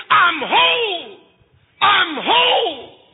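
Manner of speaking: high, slow, tearful cry of joy; joyful cry. Acted. Healed leper rejoicing, high tearful slow cry.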